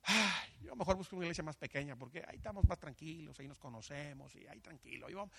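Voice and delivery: soft, whiny mock churchgoer; mocking complainer. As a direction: mimic a lazy member making excuses. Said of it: "hay gente que dice".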